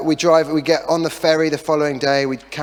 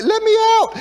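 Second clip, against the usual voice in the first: very high, louder squeal begging to be freed; trapped sat nav pleading; 5 dB louder than usual. Retold or imitated. Dad voicing the sat nav begging from the boot, very high squeal. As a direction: cry out like a gadget trapped in the boot. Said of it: "She sounds like she's trying to escape".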